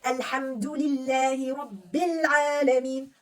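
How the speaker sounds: very slow, drawn-out Quran reading; slow connected recitation. Shown as a demonstration. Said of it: "the ayah is connected until I reach number two".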